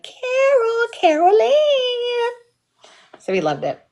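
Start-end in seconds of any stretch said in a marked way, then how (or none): 0.0-2.3 much higher, slow drawn-out sing-song; taunting call. Retold or imitated. Kids taunting grandmother as she chases them, high drawn-out call. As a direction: chant her name to tease. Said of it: "We'd be like".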